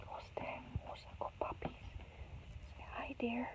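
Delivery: very soft whisper to puppies; hushed pet talk; whispered coaxing. Real, not acted. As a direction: whisper so as to gently coax a pet.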